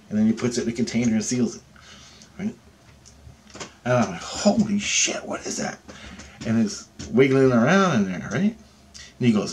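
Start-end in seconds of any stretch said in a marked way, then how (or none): 4.2-5.8 slow, breath-only gasp of awe; retold shock, nearly whispered; hushed astonishment. Retold awe at extracted thing.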